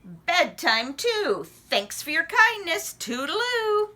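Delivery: high chirpy frog goodbye. Acted. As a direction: sing out a breezy farewell. Froggy leaving.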